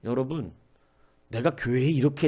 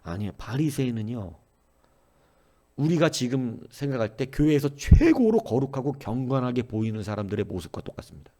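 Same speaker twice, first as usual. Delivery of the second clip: usual speaking voice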